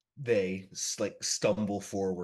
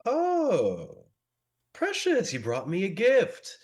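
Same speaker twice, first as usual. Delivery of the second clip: much higher, louder, delighted and theatrical; gleeful high vampire voice; 11 semitones higher, 7 dB louder, slower than usual. Acted. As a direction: swoon over the gift like a treat.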